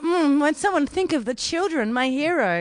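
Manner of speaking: high mock-swooning sarcasm; sarcastic swoon. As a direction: fake swoon, dripping sarcasm. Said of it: "I was like".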